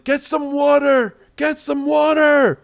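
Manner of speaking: very high, loud frantic yell. Acted. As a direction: bellow for water, frantic. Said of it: "they start yelling".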